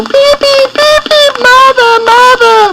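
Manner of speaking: chick calling. Acted. Chick calling for its mother from inside the snake. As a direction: squeak out a tiny chick's panicked call. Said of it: "He just had time to call".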